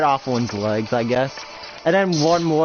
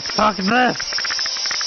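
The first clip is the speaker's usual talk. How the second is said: higher exasperated snap; fed-up villain; 8 semitones higher than usual. Pretend. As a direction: give up on the fight in disgust. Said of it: "he's like".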